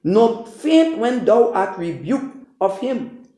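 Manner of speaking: measured, solemn Bible reading; formal King James scripture reading. Acted. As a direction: read the verses like holy writ, steady and deliberate. Reads scripture aloud before preaching.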